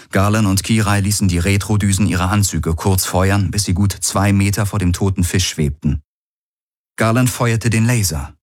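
plain reading voice

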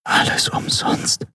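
slow, despairing whisper. A narrator's reading. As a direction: whisper it hopelessly, drawn out. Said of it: "flüsterte sie".

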